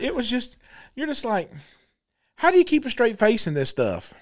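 normal talking voice

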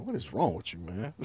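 low, soft aside of disapproval; muted disbelief. Retold or imitated. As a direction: say it under your breath, as if unheard. Voices unspoken thought about cousin.

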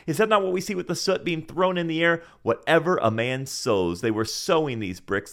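ordinary talk, nothing marked

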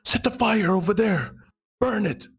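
high, half-yelled command; near yell. Acted. Bambi half-yells orders to allies in the fight, high and urgent. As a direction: shout orders over the chaos. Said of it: "she almost yells".

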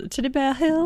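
put-on high Southern-accented child voice. Co-host naming the hill to die on, mock Southern accent. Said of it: "What accent is that?".

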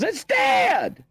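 high shrieking "it's dead"; mock scream. Imitating his mom shrieking about the dead possum, high and shouted. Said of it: "why are you screaming".

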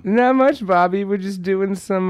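high, bright customer-service voice; chirpy and eager on the phone; upbeat phone voice. Shown as a demonstration. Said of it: "I actually talk like this on the phone".

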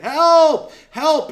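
loud, high cry for help; calling for help. Retold or imitated. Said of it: "I actually called and I said".

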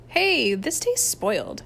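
slow, drawn-out disgusted complaint. A narrator's reading. Camper protests the spoiled soup, whining.

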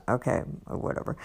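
a fast, voiceless mutter of giving up; muttered aside. Retold or imitated. Rushed muttered aside at the check-in table, giving in. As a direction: mumble it under your breath and let it go. Muttering as she gives up signing in.

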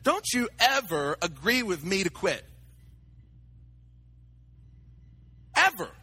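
firm, building to a sharper final word; stern rebuke. Retold or imitated. Pastor rebuking a friend who agrees he should quit, firm and sharp. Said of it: "and I said".

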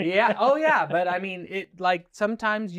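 loud, high-pitched burst of agreement; amused agreement. Real, not acted. Guest agreeing with host's furniture joke, amused and loud.

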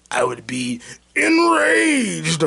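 slow, high, loud fake rage; mock rage. Imagining his footage deleted, hammed-up fury. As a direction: ham up the fury, voice cracking. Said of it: "I would be so upset".

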